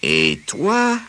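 slow, sly big bad wolf. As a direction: drawl it out like a scheming wolf. Wolf's slow challenge.

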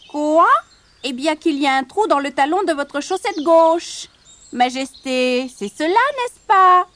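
high, loud, funny frog voice; croaking little frog. Acted. Frog reveals Barbotine's secret to the prince, croaking voice. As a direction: croak it out like a tell-tale frog. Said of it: "une petite grenouille qui sautillait en coassant".

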